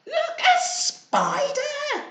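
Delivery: high, slow gasp of alarm; startled cry of look a spider; surprised gasp. Acted. Reader gasps at spider in story.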